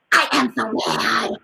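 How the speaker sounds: acted growly "so mad"; mad demo. Shown as a demonstration. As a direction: show little children how to say you're mad. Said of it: "We make the mad face".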